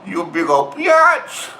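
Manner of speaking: very slow taunt leaping up high on BIATCH; high-pitched taunt. Acted. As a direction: stretch BIATCH out into a high squeal. Taunting insult, escalated repeat.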